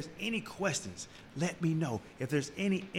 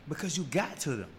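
ordinary delivery

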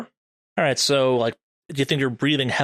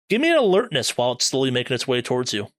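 usual speaking voice

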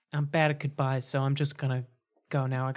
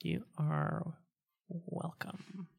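soft character voice; 4 semitones higher, 9 dB quieter, much slower than usual. Acted. Scrape's reply.

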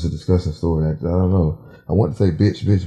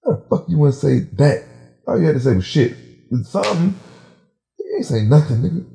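higher-pitched exasperated rebuke; heated mock indignation; retold angry scolding; 7 semitones higher than usual. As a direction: re-enact telling someone off. Acted angry confrontation in story.

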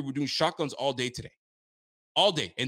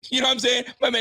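excited; 11 semitones higher, 6 dB louder, much faster than usual. Real, not acted. Hyped aside to chat.

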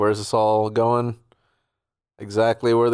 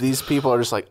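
ordinary talk, nothing marked

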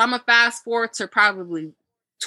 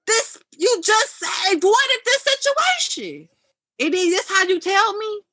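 much higher, slow, wounded reproach; hurt accusation; 11 semitones higher, slower than usual. Retold or imitated. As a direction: let the hurt push the pitch up. Hurt confrontation retold.

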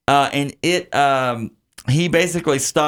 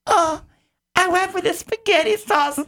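very high, squeaky fake Italian accent; awful high-pitched accented waiter impression; Pistachio. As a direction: drag out a goofy falsetto Italian. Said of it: "the way he said it".

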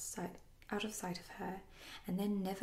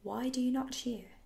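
higher, louder indignant question; hot demand; 5 semitones higher, 6 dB louder than usual. A narrator's reading. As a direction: demand their cheers with mild heat. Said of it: "he said hotly".

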